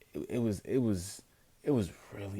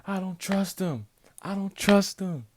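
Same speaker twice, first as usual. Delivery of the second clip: high, building louder shout; retelling screaming on stream, getting louder; 12 semitones higher, 7 dB louder than usual. Retold or imitated. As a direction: yell your suspicion at the screen. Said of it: "I was screaming".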